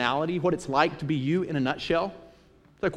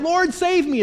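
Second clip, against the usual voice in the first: much higher, louder panicked plea; crying out; 13 semitones higher, 7 dB louder, slower than usual. Acted. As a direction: shout for rescue while going under. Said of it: "he cries out".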